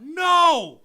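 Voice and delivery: sudden very loud, high no; emphatic no. Real, not acted. Asked if they know it all, a loud high no. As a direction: slam the answer down.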